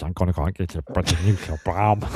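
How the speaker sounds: mock superhero voice gone harmless; least threatening Batman. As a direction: parody a tough-guy accent that has collapsed. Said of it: "least threatening Batman of all times".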